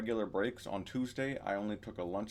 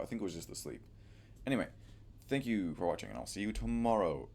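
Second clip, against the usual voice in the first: usual speaking voice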